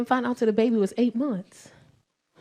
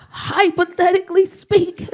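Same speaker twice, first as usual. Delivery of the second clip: nervous son; 7 semitones higher, 6 dB louder than usual. Retold or imitated. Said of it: "hit me with".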